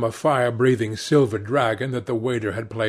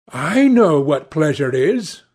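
higher, louder youthful declaration; eager cry; 5 semitones higher, 7 dB louder than usual. A narrator's reading. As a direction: lightly exclaim it with conviction. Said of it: "cried Dorian Gray".

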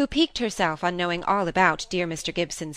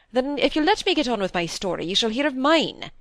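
higher, insistent pitch; out of patience; 6 semitones higher than usual. A narrator's reading. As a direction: cut through the chatter, patience used up. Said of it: "quite beyond his patience".

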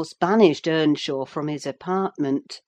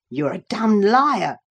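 loud, raised accusation; angry retort; 4 semitones higher, 6 dB louder than usual. A narrator's reading. Cousin calls out Catherine's falsehood, loud and angry. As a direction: contradict her hotly. Earnshaw angrily contradicts Cathy.

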